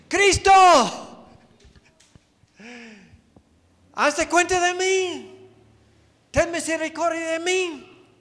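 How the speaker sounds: very high, full-force cry. Acted. Blind beggar calling Christ over the crowd, shouting hard. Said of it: "el mendigo grita más fuerte".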